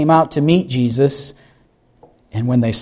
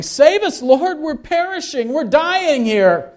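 high, drawn-out cry for rescue; panicked plea; 12 semitones higher, slower than usual. Acted. Disciples waking Jesus in the storm, panicked plea. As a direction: plead in alarm, voice pitched way up. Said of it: "and they are afraid".

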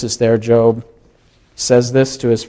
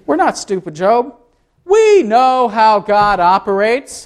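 smug friends; 7 semitones higher than usual. Acted. Friends lecturing Job, high smug voice. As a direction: talk down to Job with smug certainty. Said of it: "They said".

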